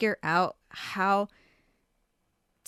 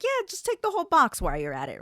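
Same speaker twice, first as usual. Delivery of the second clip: much higher and fast, mock generous; sarcastic offer; 8 semitones higher, faster than usual. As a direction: drip with fake generosity. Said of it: "I was like".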